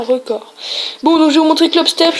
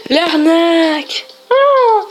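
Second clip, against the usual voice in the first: drawn-out complaint rising into a high cry; exasperated outcry; 4 semitones higher, much slower than usual. Real, not acted. Player crying rip-off after failing, exasperated outcry. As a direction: protest slowly, then wail higher.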